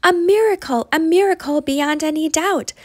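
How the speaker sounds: the donkey feels his new strength; joyful cry. A narrator's reading. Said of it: "the donkey cried out".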